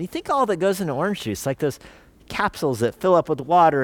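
ordinary talk, nothing marked

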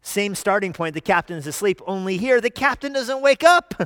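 ordinary talk

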